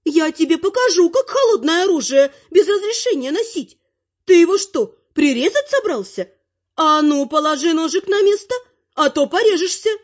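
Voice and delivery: high-pitched granny telling-off; bossy, affronted old lady, voice way up; indignant granny. A narrator's reading. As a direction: scold like an outraged grandmother. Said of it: "возмутилась бабуля".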